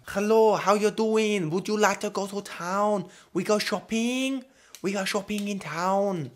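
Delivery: high, halting mock Chinese accent.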